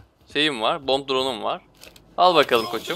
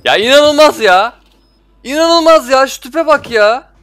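much higher, louder and slower, cracking with disbelief; incredulous outburst; 9 semitones higher, 8 dB louder, slower than usual. Real, not acted. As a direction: shriek at the absurd kill. Stunned by enemy's tube kill. (çok yüksek perdeden, inanamayarak haykırış)

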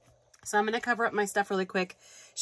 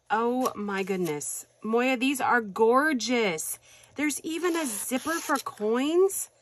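usual speaking voice

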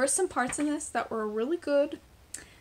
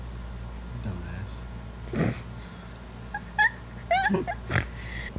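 laughing; 5 dB quieter than usual. Real, not acted. Laughs at teasing.

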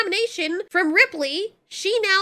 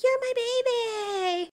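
mock doting mother; 7 semitones higher, 4 dB quieter, much slower than usual. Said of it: "She's like".

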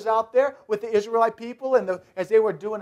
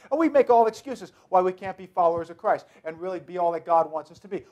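usual speaking voice

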